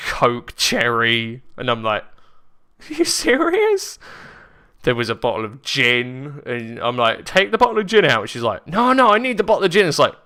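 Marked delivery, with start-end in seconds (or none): 2.9-4.0 very high, loud incredulous squeak; squeaky, incredulous quote; retold disbelief. Retold disbelief at sister's packing. 7.2-8.3 loud, high, fast order; raised-voice quote from a quarrel; retold exasperation. Acted quarrel over gin. 8.7-9.9 loud, high, fast protest; acted frantic refusal; retold frantic protest. Sister's acted protest over gin.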